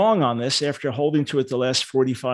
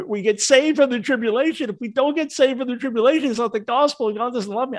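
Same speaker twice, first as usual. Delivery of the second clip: higher, pleading mock distress; imitated tearful plea; 7 semitones higher than usual. Teacher imitating upset believers defending their doctrine, mock-tearful. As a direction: imitate someone tearfully insisting, pitched up. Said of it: "people tell me that with tears".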